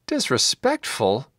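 acted outraged query, not shouted; incredulous cry. A narrator's reading. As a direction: echo it back in disbelief, slowly. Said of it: "Gideon cried".

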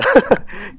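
high airy giggle; breathy laugh. Real, not acted. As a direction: let the laugh burst out. Laughter after taunt.

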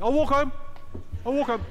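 high, loud stroppy cry; storming off. Acted. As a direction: fling the words out, high and huffy. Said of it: "she's going".

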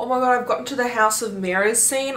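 normal talking voice

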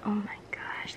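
awed whisper. Real, not acted. Fan whispering over close-up cards. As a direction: breathe it out in awe. Hushed awe over [name] card.

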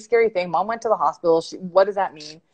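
ordinary delivery

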